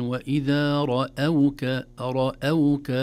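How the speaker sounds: رأوك with a light, thin hamza; slow, light hamza in رأوك; correct reading. Shown as a demonstration. Teacher recites رأوك the right way. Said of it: "لا نقول رأوك".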